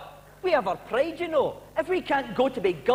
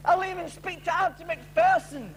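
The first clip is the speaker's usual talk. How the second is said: pleading caller; 7 semitones higher than usual. Acted. Late caller begging to speak.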